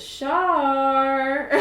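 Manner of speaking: loud, high, stretched-out proclamation; ominous apparition. Acted. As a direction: intone the name like a looming monster. Apparition announces a dreaded name.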